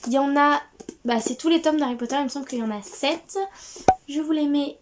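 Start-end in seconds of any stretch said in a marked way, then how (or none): none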